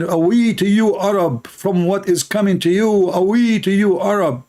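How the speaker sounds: half-hearted imitation of shouting; retold shouting. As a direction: call out a warning, only mildly shouted. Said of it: "he starts shouting and screaming".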